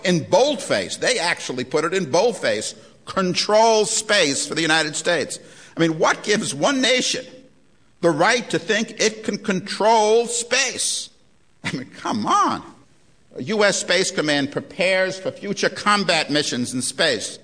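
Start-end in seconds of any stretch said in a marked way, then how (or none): 5.8-12.6 outraged disbelief, rising up; indignant. Real, not acted. Lecturer challenging the audience, rising indignant disbelief. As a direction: pitch climbing higher, incredulous.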